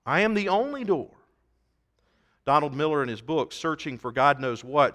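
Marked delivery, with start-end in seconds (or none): 0.1-1.1 raised pitch, stressing "only door"; emphatic declaration. Retold or imitated. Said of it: "that's what he's saying emphatically".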